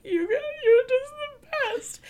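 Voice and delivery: very high gushing voice; gushing squeal. Retold or imitated. Reader recalling her love for Kieran, gushing squeal. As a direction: squeal it with tiny, high-pitched adoration. Gushing over a favourite character.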